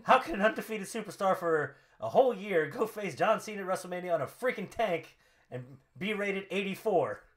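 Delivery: outraged promo read with rising disbelief; Rusev. Acted. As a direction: rant like an affronted champion. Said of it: "to which he says".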